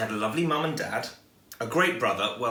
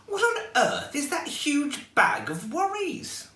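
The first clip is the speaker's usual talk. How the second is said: put-on curious old lady; slower than usual. Old lady asks about bag.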